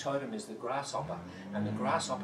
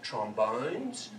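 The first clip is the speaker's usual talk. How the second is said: ordinary talk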